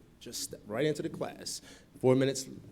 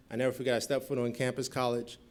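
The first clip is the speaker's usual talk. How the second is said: normal talking voice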